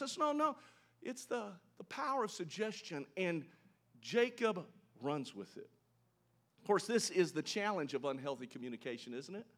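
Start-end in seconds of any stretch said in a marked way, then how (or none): none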